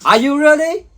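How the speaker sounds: ordinary talk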